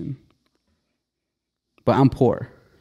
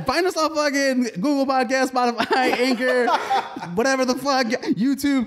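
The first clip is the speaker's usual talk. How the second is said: laughing podcast plug; 11 semitones higher than usual. Acted. Laughing rushed outro plug.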